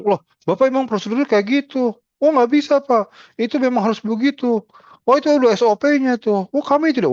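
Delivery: high, loud, indignant pushback; defensive nurse. Retold or imitated. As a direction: argue back to justify the procedure. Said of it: "kalau kitanya defensif".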